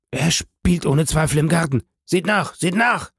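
high, urgent, panicked order; frantic urging. A narrator's reading. Father sends servants to search the garden, frantic.

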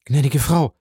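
high, urgent call. A narrator's reading. Valentine rushing to her stepmother, calling out. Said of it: "rief Valentine".

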